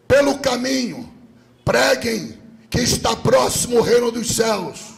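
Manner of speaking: high, ringing commands from the pulpit; emphatic preacher reading out orders; forceful scripture preaching. Real, not acted. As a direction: declaim each command with rising force.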